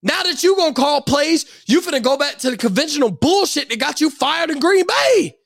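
ordinary talk

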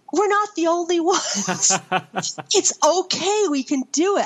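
high, laughing through the words; giggling relief from a ten-year-old; relieved laughing son. Retold or imitated. Son laughing with relief to his mother, high giggly voice. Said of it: "my son said".

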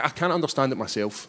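usual speaking voice

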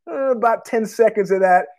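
ordinary talk

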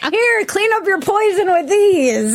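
high, slow mock-generous offer; mock helpfulness. Imagining him throwing paper towels to victims, mock helpfulness. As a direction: hand out useless help in a hammed-up high voice.

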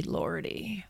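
lordy breathed out as a whisper; a sigh of a whisper, meant for nobody; whispered to herself. A narrator's reading. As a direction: let the second lordy fade into a private whisper. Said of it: "saying to herself".

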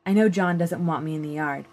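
plain reading voice

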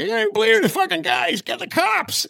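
a hammed-up Boston accent shout. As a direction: yell it like a Boston tough guy. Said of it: "fade out on the Boston accent".

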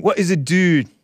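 mocking her repeated question. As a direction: parrot her nagging question with scorn. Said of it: "she kept on repeating".

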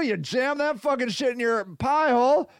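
normal talking voice

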